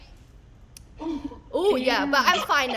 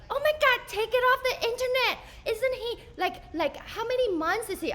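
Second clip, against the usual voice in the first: high lady voice; 10 semitones higher than usual. Retold or imitated. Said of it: "another lady's like".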